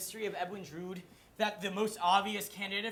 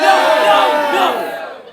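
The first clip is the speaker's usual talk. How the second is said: loud, high no no no; loud shout; 6 semitones higher, 19 dB louder, much slower than usual. Acted. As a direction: shout them down, exasperated. Host rejecting audience response.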